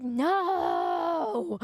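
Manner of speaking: long, high drawn-out mock wail; hammed-up horrified protest; disgusted wail. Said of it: "I was, like, disgusted".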